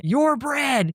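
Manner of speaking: high mock-angry yell; imitated yelling. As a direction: hurl it as a retold accusation. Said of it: "So then he's still yelling".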